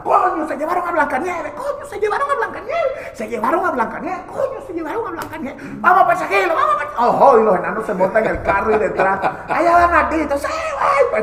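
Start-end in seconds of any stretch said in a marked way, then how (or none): 0.0-7.1 high, squeaky little-dwarf voices, laughing through it; frantic tiny men shouting the alarm; squeaky dwarf panic; panicked dwarves. Acted. 9.5-11.2 squeaky dwarf. Acted. Dwarves chasing in car.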